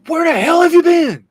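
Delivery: furious high shouted demand; mom yelling. Retold or imitated. Mother yelling at her son at the police station. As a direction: scream it at your lost kid. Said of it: "And she says".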